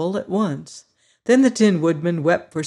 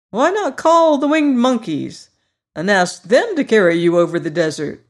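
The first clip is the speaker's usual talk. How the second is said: higher, louder cheerful straw man; Scarecrow voice; 4 semitones higher, 6 dB louder than usual. Acted. Scarecrow boasting to his friends, high and loud. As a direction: play the proud new ruler, brighter and up.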